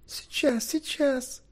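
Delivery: light high-pitched "coming" cry; calling out. A narrator's reading. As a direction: call back hurriedly in a high voice. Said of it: "закричала Кередвин".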